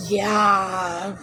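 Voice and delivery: a drawn-out mock "Yeah"; lightly mocking impression of Ariel; mimicking her "yeah". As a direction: imitate her lazy "Yeah". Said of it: "it's how she says it".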